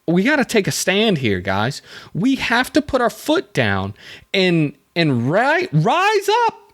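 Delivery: high-pitched call to arms, climbing to a squeak; hammed-up rabble-rousing, voice leaping up at the end; mock rallying cry. Speaking for fed-up apartment dwellers, mock rallying cry.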